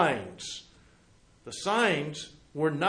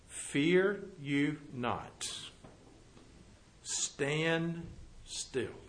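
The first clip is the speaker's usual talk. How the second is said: much softer, slow and calming; calm reassurance; 4 semitones lower, 11 dB quieter, much slower than usual. Acted. Moses calming the frightened Israelites at the sea, soft slow reassurance. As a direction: settle a frightened crowd gently.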